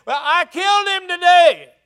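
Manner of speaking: David yelling news of Goliath; triumphant shout. Acted. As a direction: land the boast up the wall.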